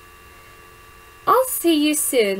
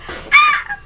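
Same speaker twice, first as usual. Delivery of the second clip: high, loud fangirl shriek; fangirl squeal; 5 dB louder than usual. Real, not acted. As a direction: squeal with delight at the cute moment. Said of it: "Sorry for coming all fangirly".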